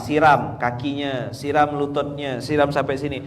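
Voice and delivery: slow instructing. Shown as a demonstration. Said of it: "instructing how to bathe child".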